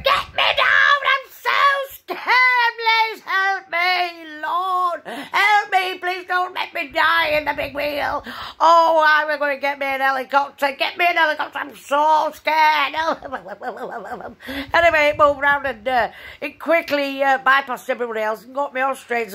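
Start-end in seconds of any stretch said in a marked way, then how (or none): 0.0-12.9 high, loud, hammed-up panic; mock terrified scream. Reliving the big wheel fright, hammed-up terror. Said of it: "I'm going". 13.0-14.7 real laugh after the mock panic. Real, not acted. Laughing at her own big wheel panic.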